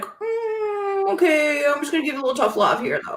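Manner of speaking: lightly mimicked friend easing into tough love; Kim. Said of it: "then you'd be like".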